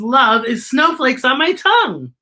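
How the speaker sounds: put-on high, loud little child voice; a happy kid calling out; child's voice. A narrator's reading. Teacher reading to class, voicing a small child. Child's line in read-aloud.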